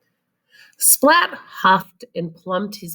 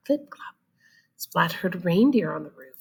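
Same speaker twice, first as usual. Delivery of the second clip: usual speaking voice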